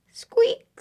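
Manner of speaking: very high squeak; mouse squeak. Acted. Mouse answers duck's greeting, high squeak.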